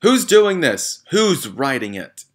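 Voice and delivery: high, loud, slow frightened bellow; yelling. A narrator's reading. Trunchbull yelling at nobody in particular. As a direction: shout it at the whole room. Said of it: "she yelled at nobody in particular".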